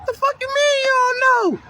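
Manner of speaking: put-on can't believe what the kid just said; incredulous outburst. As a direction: youth worker snapping back at a kid who says he doesn't know. Acted reply to silent kid.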